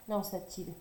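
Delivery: ordinary delivery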